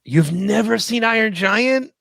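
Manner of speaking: can't believe what you just heard; incredulous. Real, not acted. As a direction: stretch the question out in astonishment. Shocked co-host hears movie unseen.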